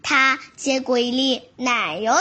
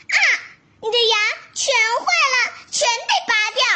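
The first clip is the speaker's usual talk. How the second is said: high, quick fox doctor; 6 semitones higher, much faster than usual. Acted. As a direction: rattle off the bad news. Fox doctor declares verdict.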